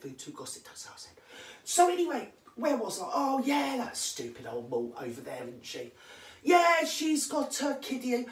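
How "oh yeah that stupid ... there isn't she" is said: ordinary talk, nothing marked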